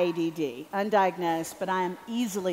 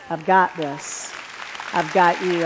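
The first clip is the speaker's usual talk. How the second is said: reassuring voice of God; 3 semitones lower, 6 dB louder, slower than usual. Retold or imitated. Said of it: "I sensed God through his spirit say".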